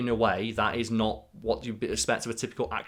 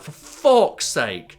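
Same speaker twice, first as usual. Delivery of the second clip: slow, louder, weary exasperation; slowed-down, fed-up deadpan indignation, a touch louder; Bruce Willis resigned indignation; 4 semitones higher, 8 dB louder, much slower than usual. Retold or imitated. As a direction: drag it out like a man who is fed up. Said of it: "his resigned indignation".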